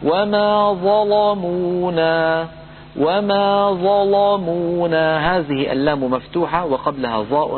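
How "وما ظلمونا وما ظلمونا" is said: slow chanted ظلمونا with a heavy لام; ظلمونا drawn out, لام full; Quranic recitation. Shown as a demonstration. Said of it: "أيضا قال تعالى".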